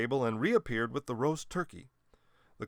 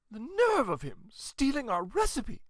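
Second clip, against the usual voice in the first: put-on high, indignant old lady outrage; a grandmother fuming at a thief; 12 semitones higher than usual. A narrator's reading. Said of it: "with fire in her eye".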